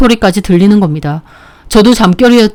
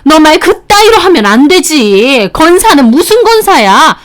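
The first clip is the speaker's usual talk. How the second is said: a mock shriek of an angry mother; mother-in-law yelling; 8 semitones higher than usual. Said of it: "장모님이 소리까지 빽 지르시더라고요". (높은 톤으로 꾸짖는 장모님, 약한 고함)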